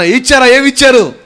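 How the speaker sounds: high, loud mock interrogation; interrogating officer. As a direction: pounce on the answer with urgent questions. Acts out a checkpoint interrogation. (అధికారిలా గట్టిగా నిలదీసే ప్రశ్న)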